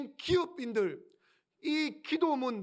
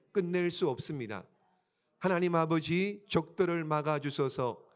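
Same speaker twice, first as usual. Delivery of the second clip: prayer recitation; 6 semitones lower than usual. Shown as a demonstration. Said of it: "자, 읽어봅니다. 시작".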